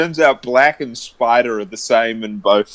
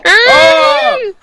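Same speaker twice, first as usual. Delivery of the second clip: very high, loud joyful cry; thrilled that the mead has arrived; delighted shout; 7 dB louder than usual. Acted. Reacting to mead at the door.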